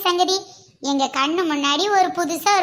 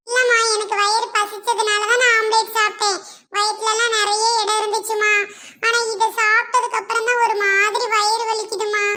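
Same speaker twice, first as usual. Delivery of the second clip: put-on lightly childish, higher complaining voice; a little boy pleading with his mum about a tummy ache; mildly whiny kid voice; whining child; 5 semitones higher than usual.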